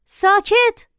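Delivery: high, abrupt order for silence; sharp scolding. A narrator's reading. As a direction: cut a shouting child off sternly. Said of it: "باز تشر زدم".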